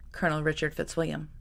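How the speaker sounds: plain reading voice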